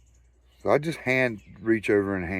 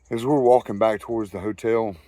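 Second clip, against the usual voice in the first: ordinary talk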